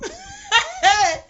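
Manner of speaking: loud high burst of mocking laughter; big laugh. Real, not acted. Mocking the opponents' tears. (grand éclat de rire moqueur)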